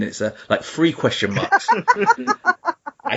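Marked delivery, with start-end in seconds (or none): 1.8-3.0 high laugh. Real, not acted. High laugh after the impression.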